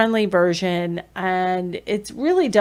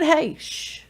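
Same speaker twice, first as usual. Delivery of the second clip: gentle hush; 8 semitones higher than usual. Shown as a demonstration.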